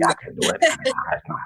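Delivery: ordinary talk, nothing marked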